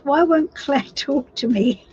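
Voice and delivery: reading the question aloud and cracking up; giggling through the words she reads out; laughing while reading. Real, not acted. Reading a viewer's sad question, breaking into real laughter. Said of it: "I don't mean to laugh".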